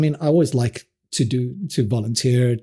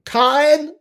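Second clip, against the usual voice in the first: calling a name; 12 semitones higher than usual. Retold or imitated. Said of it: "they come in and go".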